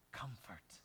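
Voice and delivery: a soft breathy whisper of "comfort."; God whispering "comfort.". Acted. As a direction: breathe the word "comfort." like a secret. God's tender word to sufferers.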